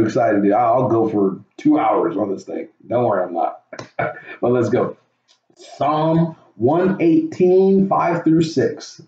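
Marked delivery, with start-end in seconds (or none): 5.6-9.0 slow, solemn verse announcement; preacher reading out the psalm; scripture reading. Acted. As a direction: read the reference slowly and deliberately.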